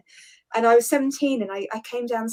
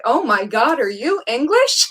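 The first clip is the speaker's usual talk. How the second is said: louder, slower, amazed American officer; a cop who can't believe he met an English girl; excited New York cop; 7 dB louder, slower than usual. Retold or imitated. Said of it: "he was like".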